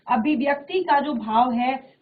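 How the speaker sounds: normal talking voice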